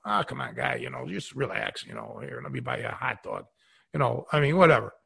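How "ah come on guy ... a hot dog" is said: casual brush-off. Retold or imitated. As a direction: blow it off quietly, like it's no big deal. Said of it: "blew it off".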